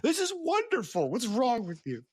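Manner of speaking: high, gushing, overjoyed; high mock gush defending cute videos; mock delighted. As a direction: squeal it like a delighted fan. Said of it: "I was like".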